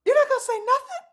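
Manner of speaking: high, rising disbelief; can't believe it; exasperated high question; incredulous. Real, not acted. Incredulous at silence.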